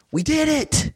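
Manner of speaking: put-on high, gleeful child's cry; joyful child. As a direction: squeal it like a kid who just won the championship. Daughter hugging him after winning.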